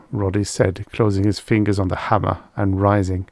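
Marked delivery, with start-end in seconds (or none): none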